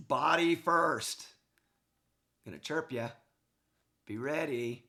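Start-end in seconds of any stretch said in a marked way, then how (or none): 0.1-1.3 punchy coaching call; emphatic. Real, not acted. Coach introducing the next clip.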